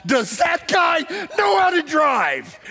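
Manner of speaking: high, loud, slow fed-up yell; a frustrated helper yelling across a snowy ditch; retold yell. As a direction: yell it out at the driver, exasperated. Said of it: "I finally yell out".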